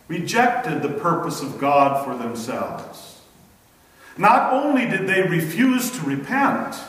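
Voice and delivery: ordinary talk, nothing marked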